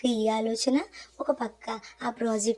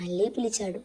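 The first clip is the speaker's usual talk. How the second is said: ordinary delivery, nothing marked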